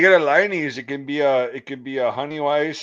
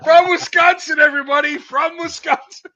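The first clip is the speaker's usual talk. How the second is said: high, loud mock TV shout; shouted announcement; 9 dB louder than usual. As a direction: yell it to the whole audience like a reporter. Mock reporter announcing to viewers.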